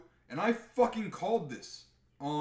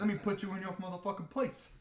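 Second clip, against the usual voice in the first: fast, cocky threat in a put-on voice; The Rock threatening; 6 semitones higher, faster than usual. The Rock putting his cousin in place, cocky threat. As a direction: swagger through it quickly. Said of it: "And The Rock's like".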